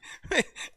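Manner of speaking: breathy, voiceless laugh; short real laugh; amused chuckle. Real, not acted. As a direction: chuckle helplessly between remarks. Amused at viewer's question.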